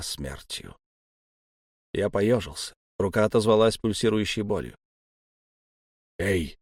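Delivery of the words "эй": ordinary delivery, nothing marked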